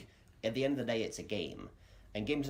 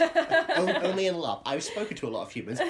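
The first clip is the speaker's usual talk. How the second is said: laughing; 9 semitones higher, 10 dB louder than usual. Real, not acted. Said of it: "but have you?".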